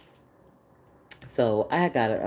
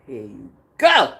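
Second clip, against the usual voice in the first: loud God voice; 11 dB louder than usual. Retold or imitated. God's voice in retelling.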